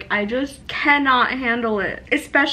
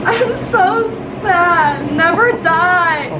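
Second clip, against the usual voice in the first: slow, louder, tearful plea; tearful pleading; 4 semitones higher, 3 dB louder, slower than usual. Real, not acted. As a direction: beg him through tears. Pleading to boyfriend while crying.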